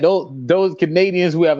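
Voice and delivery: ordinary talk, nothing marked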